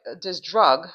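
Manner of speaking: usual speaking voice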